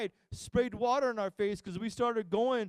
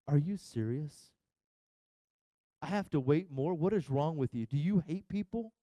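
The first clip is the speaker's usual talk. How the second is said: grumbling; 7 semitones lower than usual. Retold or imitated. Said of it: "grumbling at the ride staff".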